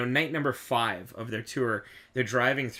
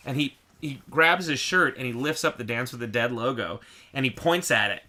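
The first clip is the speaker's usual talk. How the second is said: ordinary delivery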